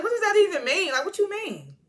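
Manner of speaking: high, incredulous questioning; baffled disbelief. Retold or imitated. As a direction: demand an explanation, voice shooting up. Baffled reaction to Hillary's comment.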